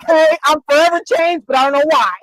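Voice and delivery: high, loud and puzzled; bewildered high exclaim. Retold or imitated. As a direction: blurt it out, baffled. Quoting herself, baffled.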